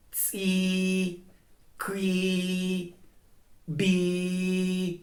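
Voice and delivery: "tsi", "qui", "bi" each ending in a long level vowel; consonant before tone. Shown as a demonstration. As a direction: start on the consonant, then hold the "i" steadily. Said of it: "prior you emit the".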